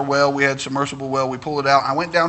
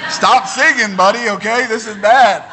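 wife complaining; 4 semitones higher, 6 dB louder than usual. Retold or imitated. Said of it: "my wife wants to cut the water off".

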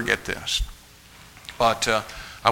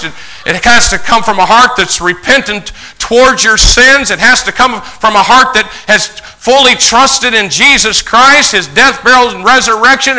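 loud, high, building pulpit crescendo; preaching at full force; 10 semitones higher, 10 dB louder than usual. Acted. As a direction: drive each repeated phrase higher and louder.

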